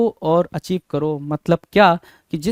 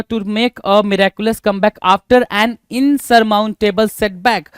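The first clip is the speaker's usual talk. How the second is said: usual speaking voice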